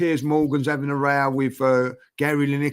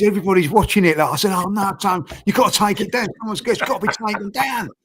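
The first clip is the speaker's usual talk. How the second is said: higher, urgent retold phone exchange; mock panic; 5 semitones higher than usual. Telling mate Tony to take it down, panicked. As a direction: relive the alarm of that call.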